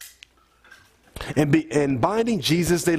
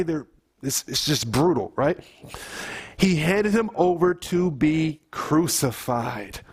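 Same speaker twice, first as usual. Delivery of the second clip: ordinary delivery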